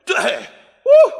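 high, loud woo of shock; a sudden loud cry as the blanket comes off; excited whoop. Real, not acted. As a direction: let out a startled whoop. Pulling blanket off, exclaiming.

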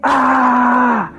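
high, sustained "aaah" scream; waking yell. Retold or imitated. Waking beside his wife from the dream, high yell. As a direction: wake up with a long high cry. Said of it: "I woke up going".

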